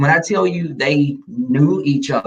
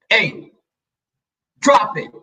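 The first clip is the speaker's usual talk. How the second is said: high, short order; firm, high-pitched warning; bad cop command; 7 semitones higher, slower than usual. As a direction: stop the pet with one raised command. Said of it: "I had to be the bad cop".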